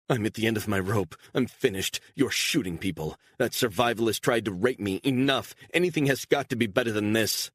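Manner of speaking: a cornered woman unloading on her captor, desperate; desperate outburst. Acted. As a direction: spill it all out, at the end of her rope. Beatrice breaking down, confronting Stone.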